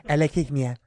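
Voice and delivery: put-on lisped, Spanish-accented "Alexithymia"; mock Spanish accent. Copying a colleague who sounds like from Spain, mock Spanish accent. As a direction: imitate a Spaniard saying "Alexithymia". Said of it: "It sounds like from Spain".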